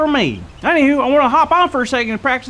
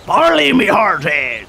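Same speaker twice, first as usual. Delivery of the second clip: low, slow growling pirate voice; 4 semitones lower, slower than usual. Shown as a demonstration. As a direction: rumble it like a salty old pirate. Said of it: "lower your voice to, uh, like a, like a growl".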